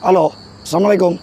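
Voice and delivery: loud, fast call into the woods. Real, not acted. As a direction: call out a greeting to whoever's there. Calling out into the forest.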